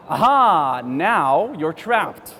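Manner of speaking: loud, slow gloating shout; triumphant yell. Acted. Teacher voicing the bear yelling at the trapped bee. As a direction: crow over trapping the bee. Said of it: "yells Mister Bear".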